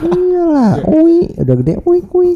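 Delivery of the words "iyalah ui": put-on very high baby squeal. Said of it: "Suara bayi". (suara bayi melengking)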